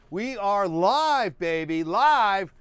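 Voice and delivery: hyped announcement. Real, not acted. Announcing live stream.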